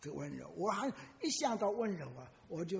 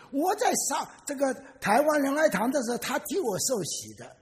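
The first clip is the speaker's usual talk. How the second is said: excited retelling; 9 semitones higher, 8 dB louder than usual. Retold or imitated. Quoted man explains excitedly.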